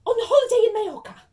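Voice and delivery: high, very loud irritable snap; snapped. Acted. Aunt answering her husband's suggestion, snapping. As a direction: snap back shrilly at a useless suggestion. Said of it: "snapped Aunt Petunia".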